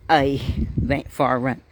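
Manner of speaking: ordinary delivery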